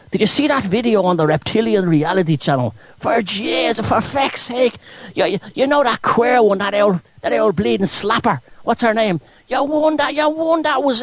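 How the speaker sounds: high, hammed-up Irish accent. Irishman warning that the Antichrist is coming, mock Irish accent. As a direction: play an agitated Irish conspiracy believer, high-pitched. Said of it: "I'll do it in an Irish accent".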